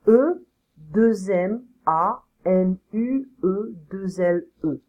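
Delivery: slow letter-by-letter dictation; deliberate French spelling with spaces between letters. Shown as a demonstration. Teacher spells a name aloud.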